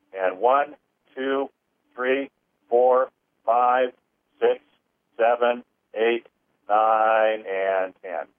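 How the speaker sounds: slow, measured count, each number set apart; slow healing count. Acted. Healer counting listeners through an abandonment clearing, slow and measured.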